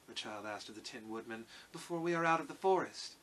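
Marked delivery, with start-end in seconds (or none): 1.7-3.1 put-on high girlish questioning voice; little girl. A narrator's reading. Dorothy asks how long the walk will be, high girl's voice. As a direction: ask it as a child, pitched up. Child questions the Tin Woodman.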